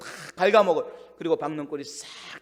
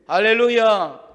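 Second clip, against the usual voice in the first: drawn-out, louder, slow hallelujah; 4 semitones higher, 9 dB louder, slower than usual. Real, not acted. Preacher leading the congregation with a louder, drawn-out hallelujah. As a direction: call it out to the whole congregation.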